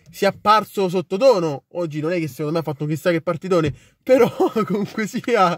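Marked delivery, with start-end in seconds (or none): 4.0-5.6 laughing. Real, not acted. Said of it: "perché ridi?".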